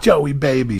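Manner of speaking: high, loud, teasing voice; her flirty reply. Retold or imitated. The receptionist answering me, loud teasing voice. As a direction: purr it back playfully.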